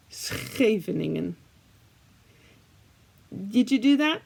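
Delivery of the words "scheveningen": Scheveningen slowly, with the guttural Dutch sch; Dutch pronunciation. Shown as a demonstration. Modeling Scheveningen for viewers to repeat. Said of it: "repeat after me once more".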